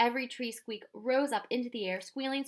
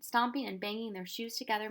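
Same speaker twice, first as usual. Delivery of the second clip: ordinary delivery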